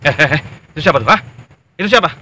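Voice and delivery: loud thug barking orders; bully your men into obeying; bullying boss. Acted. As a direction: gang boss to henchmen, loud and bossy. Gang boss bullies henchmen.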